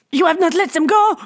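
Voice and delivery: very high, urgent gasping voice; urgent captain. A narrator's reading. Captain warns Bibot about the cart, urgent. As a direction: blurt it in alarm.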